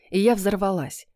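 plain reading voice

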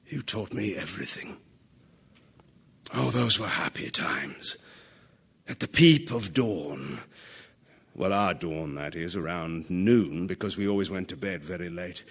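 low, soft and wistful; wistful reminiscence. Acted. King recalling happier times with his old friend, wistfully. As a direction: sink into a hushed, private memory.

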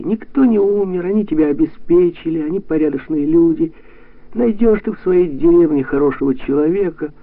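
high, forceful encouragement; rally the listener insistently; animated, upbeat urging pitched high; brisk pep talk. A narrator's reading. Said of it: "Тимоша говорил бодро".

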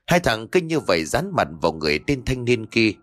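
ordinary delivery, nothing marked

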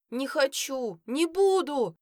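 put-on high, loud child's howl of refusal; wailing yell. A narrator's reading. Son refusing to have his tooth pulled, howling. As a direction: howl it like a stubborn kid. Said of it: "завопил сын".

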